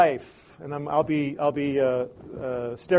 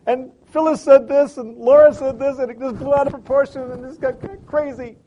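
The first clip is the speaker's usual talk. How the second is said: high, loud, breaking complaint; upset wife; 10 semitones higher, 11 dB louder than usual. Acted. Wife at the sink unloading her bad day, upset and high. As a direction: pour it out near tears, pitch up. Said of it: "she breaks and she goes".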